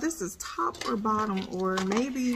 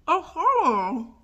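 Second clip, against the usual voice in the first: talking around dental tray; impression tray in mouth; 5 semitones higher, 5 dB louder, much slower than usual. Real, not acted. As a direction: mouth held open by tray.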